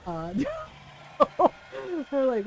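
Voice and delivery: usual speaking voice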